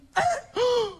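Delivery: high startled gasp; shocked gasp. Retold or imitated. Tourist gasping when a cab finally stops. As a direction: gasp as if the cab really stopped.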